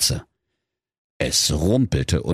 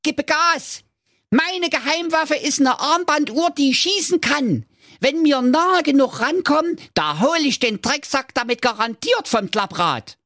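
high squeaky dwarf voice, eager; boasting dwarf. Acted. Dwarf Thorsten shows off his watch gun, squeaky and eager. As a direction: brag about the watch gun.

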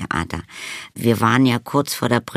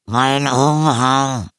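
higher, very slow hollow wail; muffled vampire wail; 4 semitones higher, 4 dB louder, much slower than usual. A narrator's reading. Hungry vampire hidden in cupboard, muffled wailing. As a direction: wail it long from inside a cupboard. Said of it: "schrie eine dumpfe Stimme aus dem Schrank".

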